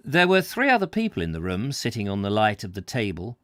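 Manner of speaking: plain reading voice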